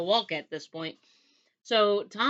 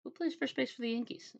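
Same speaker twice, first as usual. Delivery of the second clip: hushed, higher retold question; sly running gag; 5 semitones higher, 8 dB quieter than usual. He teases the time traveler with the old question, hushed. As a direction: slip it in softly after a long pause.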